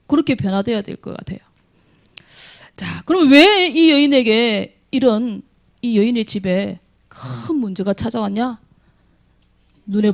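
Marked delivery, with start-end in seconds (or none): none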